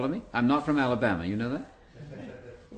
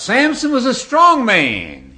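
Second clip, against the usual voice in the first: put-on booming, drawn-out American pulpit accent, pitched very high; American preacher accent; 12 semitones higher, 10 dB louder, much slower than usual. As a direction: shout it slowly like an American preacher. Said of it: "riveted by this American accent".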